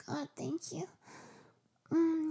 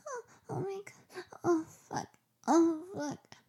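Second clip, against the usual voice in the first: climax; 2 semitones higher, 6 dB louder than usual. Acted. Climax in roleplay.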